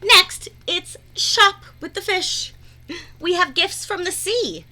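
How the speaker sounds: high, loud showman announcement; game show announcer. Acted. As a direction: announce it like a TV host. Announcing next show segment.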